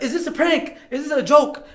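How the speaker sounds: high-pitched, urgent questioning; clamoring reporters. Retold or imitated. Reporters meeting them off the plane, high clamoring mock questions. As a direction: imitate a press pack firing questions. Voices crowd of news people.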